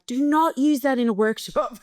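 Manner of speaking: put-on high, firm teenage objection; high-pitched, emphatic ban; mock protest. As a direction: imitate kids laying down the law. Kids forbidding her stories.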